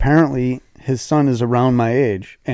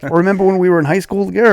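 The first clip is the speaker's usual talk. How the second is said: eager; 7 semitones higher, 5 dB louder, faster than usual. Retold or imitated. Stranger reminiscing eagerly.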